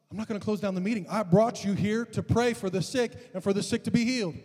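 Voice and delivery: indignant pastor, acted out; insistent refusal. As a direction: retell a pastor standing firm. Pastor argues with the evangelist.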